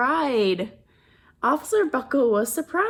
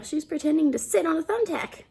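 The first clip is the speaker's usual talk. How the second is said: ordinary delivery, nothing marked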